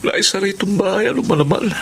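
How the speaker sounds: high, hurried fluster; embarrassed father rushing his words. Acted. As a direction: babble out the excuse. Flustered father explaining himself.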